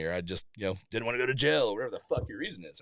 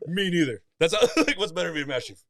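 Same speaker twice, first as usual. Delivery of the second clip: laughing retelling; 5 semitones higher, 5 dB louder, faster than usual. Real, not acted.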